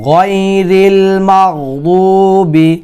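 slow, drawn-out verse recitation; Quran recitation. Shown as a demonstration. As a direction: recite slowly, stretching the long vowels and the final syllable.

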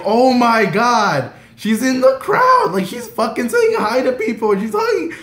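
high, loud, stretched fangirl screaming; reliving it as a starstruck scream. Retold or imitated. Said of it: "I was screaming".

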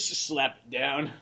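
normal talking voice

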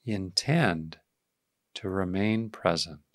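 calm, unhurried meditation voice; guided meditation. Real, not acted. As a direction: ease listeners into awareness, leaving space after each phrase.

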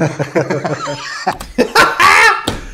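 long real laugh, high; hearty laughing fit. Real, not acted. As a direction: burst out laughing. Laughs at his own joke.

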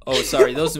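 normal talking voice

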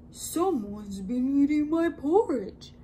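a touch of big-bear outrage; great huge voice. A narrator's reading. Papa Bear finds his porridge eaten, lightly voiced huge voice. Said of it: "in his great huge voice".

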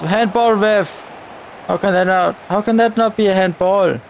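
normal talking voice

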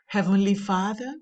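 normal talking voice

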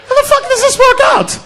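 laughing, very high "how the fuck"; high incredulous. Acted. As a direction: squeak out the question in disbelief. It's like, repeated higher.

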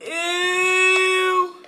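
disgusted ew. Real, not acted. Disgusted cry.

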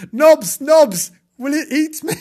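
high hammed-up plea, breaking into laughter; frightened kitten. Kitten asking the dog for help, frightened. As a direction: cry out for reassurance in a high voice. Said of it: "frightened poor Timbo".

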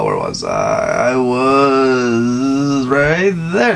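stretched slow, searching for the line; very slow drawl. Real, not acted. Reader losing the place, slowly drawling it out. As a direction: drag out each word while finding the spot.

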